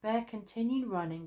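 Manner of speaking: usual speaking voice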